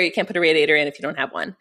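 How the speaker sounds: ordinary talk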